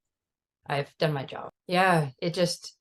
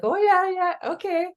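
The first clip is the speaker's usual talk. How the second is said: gentle reassurance; 14 semitones higher, 5 dB louder than usual. Retold or imitated. Imitating the gentle helper reassuring a worried mom. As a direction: echo the lady's gentle okay, pitched up. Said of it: "But she was gentle".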